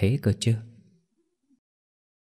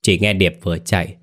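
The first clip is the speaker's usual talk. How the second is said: ordinary delivery, nothing marked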